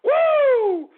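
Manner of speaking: very high, loud whoop; excited woo. Acted. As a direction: let out a party whoop. Toasting with scotch.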